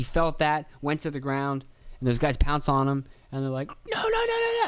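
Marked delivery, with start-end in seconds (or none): 3.7-4.6 very high frantic yelled no's; mock agents yelling. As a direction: call out like guards tackling someone.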